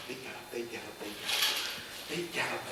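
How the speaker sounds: fading whisper. A narrator's reading. Gallop fades into the distance.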